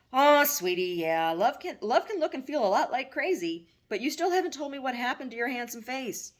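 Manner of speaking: a hint of a doting mother; mother's voice. Mother comforting her grown son, lightly voiced mom.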